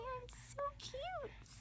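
high breathy whisper, slow; whispered baby voice. Pretend.

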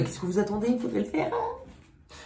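high, squeaky comic nagging; mock cacou voice. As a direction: push it up into a teasing squeal. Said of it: "On dirait les cacos".

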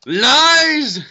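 high, loud yell of protest; indignant shout. Real, not acted. Gamer yelling at the screen in outrage. Shouting at game while playing.

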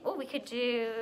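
ordinary delivery

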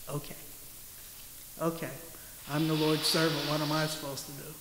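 meek timid Mary. Retold or imitated. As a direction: shrink away like a frightened servant. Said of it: "picture of Mary going".

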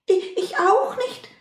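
very high little mouse voice; high-pitched peep of a mouse; squeaky mouse. Acted. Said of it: "piepste die Maus".

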